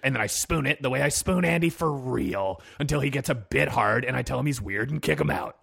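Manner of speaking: normal talking voice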